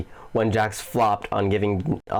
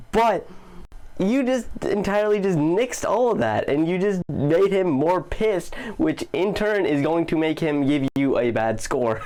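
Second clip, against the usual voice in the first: ordinary talk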